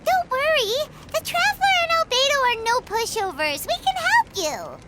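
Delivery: eager reassurance. Acted. Reassuring a friend in danger.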